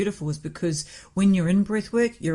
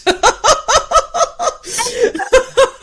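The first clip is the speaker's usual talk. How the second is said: loud high laugh; 9 dB louder than usual. Real, not acted. Laughing at own story.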